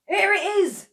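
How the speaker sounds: high, loud excited shout; a grandad bursting into the kitchen, crying out high and loud. A narrator's reading. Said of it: "cried Mister Killiecrankie".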